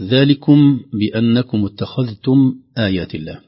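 slow, measured recitation of ذلكم بأنكم; careful recitation. Shown as a demonstration. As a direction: recite it clearly for the class. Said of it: "نظرة بالأعين في المصحف". (تلاوة مرتلة بتأنٍ)

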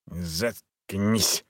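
low, soft grumble from the dark; disembodied voice, grumbling. A narrator's reading. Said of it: "ворчливо произнёс голос из темноты".

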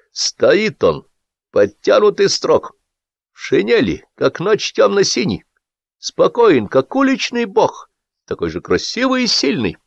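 high, slow poetry recitation; reading verse aloud. A narrator's reading. Boss reading a poem out to his staff, declamatory. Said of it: "зачитал".

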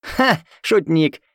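slow laughing jab; guffawing. Acted. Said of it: "заржал Игорь".

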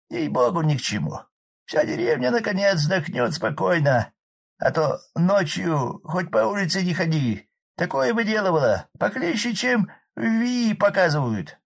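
high loud rough village man; hoarse peasant shout. Acted. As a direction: bellow over the roaring fire. Drunk Arkady gossiping.

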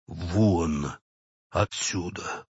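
low, strangled dismissal; quiet, choked. A narrator's reading. Clerk orders the old woman out, quiet and choked. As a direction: hold back fury in a hushed, low voice. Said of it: "сказал тихим, придушенным голосом".

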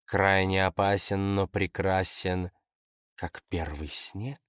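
slow, soft, intrigued recital; teasing, hushed, lingering delivery; musing quotation. Acted. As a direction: quote it slowly and softly, sizing someone up. Fox musingly quotes the description at him.

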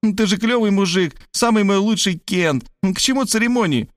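high, bright relieved exclamation; exclaiming. A narrator's reading. Varvara sighing with relief to Rob, high. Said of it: "воскликнула она".

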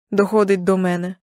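ordinary delivery, nothing marked

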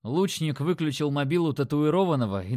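plain reading voice

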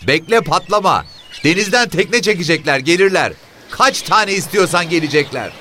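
very high-pitched, shrill yell; woman shouting. A narrator's reading. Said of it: "Kadın tekrar bağırdı". (cırlak sesle bağıran kadın)

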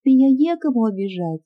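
lightly voiced sobbing girl. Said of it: "рыдая".

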